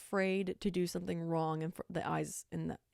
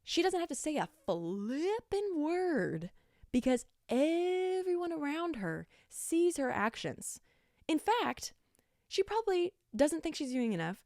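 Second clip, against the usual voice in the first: emphatic high praise; 8 semitones higher, 4 dB louder than usual. Real, not acted.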